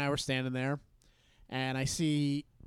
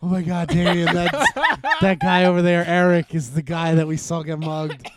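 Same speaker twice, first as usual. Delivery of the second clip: frantic realization; 8 dB louder than usual. Retold or imitated. Rushing to tell Damien, shocked.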